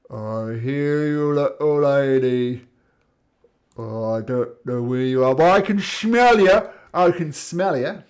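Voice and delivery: a giant sniffing out its prey, growing loud; menacing blind giant. Acted. Blind giant to the little lady he hunts, menacing.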